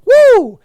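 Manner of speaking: high loud whoop; excited woo. Real, not acted. Host hyped about a great voice, loud high woo. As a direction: let out a big whoop.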